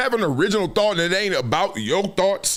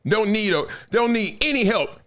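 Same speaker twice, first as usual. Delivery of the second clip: ordinary talk, nothing marked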